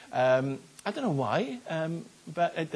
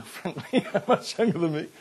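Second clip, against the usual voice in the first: louder, higher, through a laugh; 4 semitones higher, 6 dB louder than usual. Real, not acted. Pastor joking about younger colleagues, laughing. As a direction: laugh while admitting it. Amused aside about his own age.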